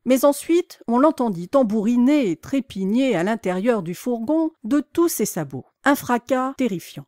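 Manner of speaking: ordinary delivery, nothing marked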